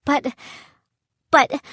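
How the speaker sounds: high flustered sputter. A narrator's reading. Boy protests to the pirate captain, sputtering. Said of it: "I sputtered".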